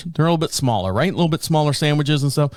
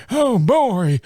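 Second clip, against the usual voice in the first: higher, put-on "Oh, boy."; a mock-excited dad reacting to his pizza; dad's delight; 8 semitones higher than usual.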